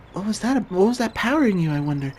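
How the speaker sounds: a high cheerful companion voice; bright character voice. Acted. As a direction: lift up high and play her cheerfully. Voicing a female party member.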